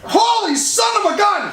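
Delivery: high-pitched playful yell of astonishment; big mock-startled holler for laughs; startled exclamation. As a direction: cry out in hammed-up shock.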